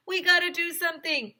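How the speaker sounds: high, loud call to act; urgent shout. Acted. Kids urging help, urgent shout. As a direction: urge everyone on. Excited crowd at pizza.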